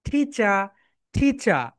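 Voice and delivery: British "টিচার" ending in open "cha"; British accent. Shown as a demonstration. Teacher leads everyone in repeating, British accent. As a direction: drill "টিচার" in British style, no final r. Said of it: "British sound হচ্ছে টিচার".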